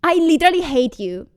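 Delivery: fast, high, loud lovers' spat; mock exasperation. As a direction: fire it out quickly in playful outrage. Acted lovers' spat after teasing.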